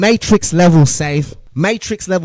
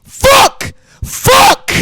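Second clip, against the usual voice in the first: high, loud panicked shout; explosive yelled swear; shouted curse; 9 dB louder than usual. Retold or imitated. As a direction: yell it like you're about to crash. Real panic reliving crash.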